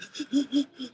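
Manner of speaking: a mock sharp crying intake of breath; sobbing gasp. Recalling crying in his truck, a mock sobbing gasp. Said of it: "I'm just sitting there like".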